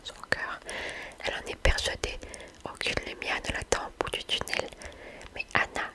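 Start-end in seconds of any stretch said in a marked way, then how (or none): none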